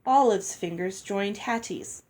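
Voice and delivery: ordinary delivery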